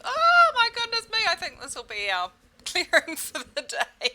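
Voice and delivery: high, loud, laughing delight; delighted laughing exclaim. Real, not acted. Coach cracking up at her own garbled clearing, loud and laughing. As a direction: burst out laughing at the tongue-twister.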